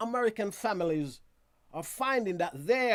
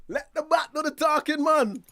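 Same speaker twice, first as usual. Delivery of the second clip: higher and louder, like a rallying cry; emphatic call; 6 semitones higher, 6 dB louder than usual. Acted. Host urging West Indies cricketers, loud rallying call. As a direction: shout the motto to the team. Slogan repeated once more.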